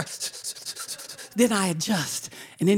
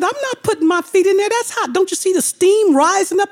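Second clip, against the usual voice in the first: high, loud, fast protest; mock panicked refusal; 9 semitones higher, 5 dB louder than usual. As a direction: refuse it in a rush. Refusing hot water.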